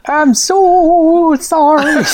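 high, slow, drawn-out sorry; delivery guy apology. Acted. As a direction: apologise like a late pizza guy. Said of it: "like it's a late pizza".

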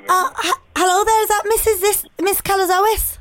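high-pitched put-on Scouse caller; hesitant prank caller in a Scouse accent; Liverpool accent. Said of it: "Should we Liverpool?".

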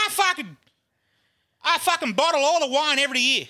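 high-pitched hammed-up wail; mock-sob the complaint in a very high voice; comically wailing, aggrieved; crying. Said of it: "Starts really fucking crying".

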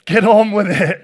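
loud laughing shout from the crowd; a friendly yell of get on with it, through laughter; laughing call-out. Real, not acted. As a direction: heckle him cheerfully to hurry up. Congregation heckles the hesitant preacher.

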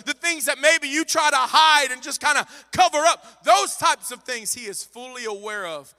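loud, high, driving sermon cry; impassioned preacher voice; full-force preaching. Acted. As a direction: preach it out over the music.

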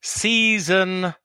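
slow, high show announcement; announcer voice. Acted.

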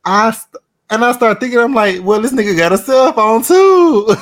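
high-pitched, incredulous squeal; amused disbelief. Retold or imitated. Friend retelling the panhandler's Cash App request, incredulous. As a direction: voice shooting way up in disbelief.

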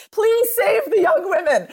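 high, hammed-up plea; mother's generation pleading. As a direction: mock-desperate appeal of an older woman. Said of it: "All of my mother's generation have told me".

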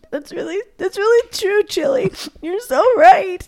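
high-pitched tearful squeak; imitated crying. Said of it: "like, crying".

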